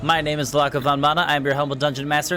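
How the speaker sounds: ordinary talk, nothing marked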